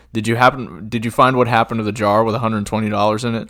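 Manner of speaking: loud courtroom lawyer voice; lawyer's question. Retold or imitated. Lawyer questioning Rooster on the stand, loud and pointed. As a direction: press him like a prosecutor.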